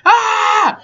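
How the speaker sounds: high, mock scream; Tate. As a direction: scream it high, imitating him. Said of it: "when Tate was screaming out".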